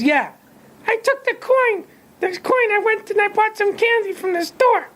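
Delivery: put-on high little boy cheerfully confessing. A narrator's reading. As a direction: chirp it like a small child owning up. Child voiced in story.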